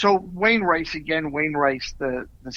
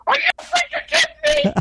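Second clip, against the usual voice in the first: very high, squeaky indignant disbelief; mock outraged extra. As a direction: play the jealous extra shrieking in disbelief. Indignant extra questioning him.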